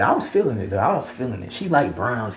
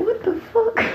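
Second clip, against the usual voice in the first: much higher, squeaky shocked "What the fuck?"; shocked inner thought. Retold or imitated. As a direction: squeal it like your inner voice just got startled. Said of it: "like, in my mind".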